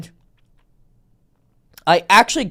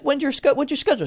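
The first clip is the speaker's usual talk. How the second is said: mock oblivious guy; 9 semitones higher, much faster than usual. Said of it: "you're like".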